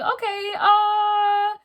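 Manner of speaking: high, strained okay then a stunned uh; shocked okay. Retold or imitated. Alone in the empty parking spot, a high shocked okay. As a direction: catch the breath as the car is gone. Finding car missing.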